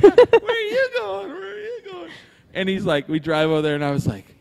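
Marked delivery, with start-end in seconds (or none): 0.0-2.1 excited calling out. Pretend. Calling out high to airplanes while waving, excited. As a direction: sing out to the airplanes like a kid.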